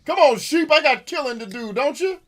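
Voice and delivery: high, loud holler at sheep; high-pitched mock-impatient hollering; playful impatient yell. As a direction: shout at the sheep to hurry up. Yelling at sheep to fight.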